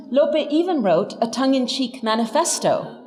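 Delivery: ordinary talk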